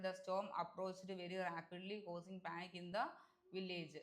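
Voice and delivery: slow, careful read of the corrected sentence. Shown as a demonstration. Teacher reading the corrected sentence slowly to students. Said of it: "corrected sentence read slowly".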